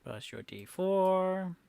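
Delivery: held, lifted final word; drawn out. Real, not acted. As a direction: draw out the last word slowly. Game master repeats roll, stretched.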